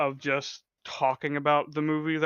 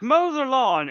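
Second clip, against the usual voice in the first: raised, high mother's command; imitating a demanding mother, loud and high; mock bossy order; 9 semitones higher, 7 dB louder, slower than usual. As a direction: call it out as a bossy mom.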